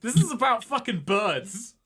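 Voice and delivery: disgusted letdown, played for laughs; disappointed. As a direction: feel badly let down by a book. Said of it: "Aegis is disappointed".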